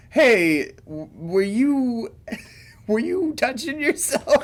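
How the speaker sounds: hesitant, high-pitched parent breaking into laughter; lightly hammed-up nosy parent; mock awkward parent. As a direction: stumble into an awkward question, cracking up. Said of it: "the parents, the m-, like, talking to Sam".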